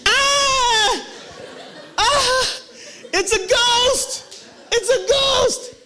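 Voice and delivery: high, shrieking cries of a terrified disciple; a disciple yelping in hammed-up terror at a ghost. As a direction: scream it's a ghost, high and panicked. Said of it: "And then it's this".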